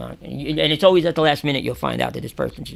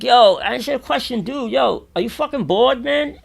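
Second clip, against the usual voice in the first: high, loud retold challenge; calling out; 11 semitones higher, 9 dB louder than usual. Teller questions the man in the cell across, high loud calling. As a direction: call it across to another cell.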